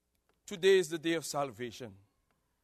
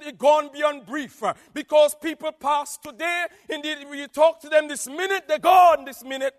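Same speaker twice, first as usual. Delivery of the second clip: louder, higher sermon delivery; preaching; 8 semitones higher, 9 dB louder than usual. Acted. Preacher warning the church, louder. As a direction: press the point home.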